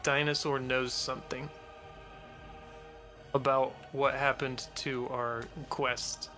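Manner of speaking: ordinary talk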